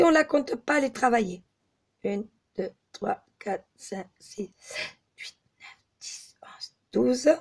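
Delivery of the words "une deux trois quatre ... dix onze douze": whispered count, much softer; counting under breath. Real, not acted. Crochet teacher counts stitches to herself, whispering.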